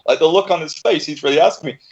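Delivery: ordinary delivery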